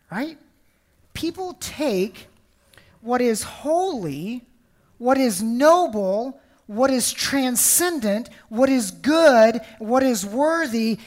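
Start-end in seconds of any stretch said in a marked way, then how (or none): 1.1-11.0 slow, each phrase lifted and stressed; slow, building preacher's list; preaching emphasis. Real, not acted. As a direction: build each what is slowly like a sermon. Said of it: "preaching builds".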